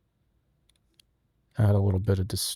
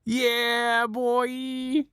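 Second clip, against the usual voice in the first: high silly voice; 13 semitones higher than usual. Pretend. Processed clip played back.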